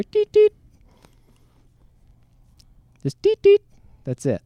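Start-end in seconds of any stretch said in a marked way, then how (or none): 0.0-0.5 high, short electronic beeps; imitated watch beep. Teller imitating the sound heard in the dark, watch beep. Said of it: "we just hear". 3.2-3.6 high, short electronic beeps; imitated watch beep. Teller imitating the sound heard in the dark, watch beep. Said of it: "Just that little sound".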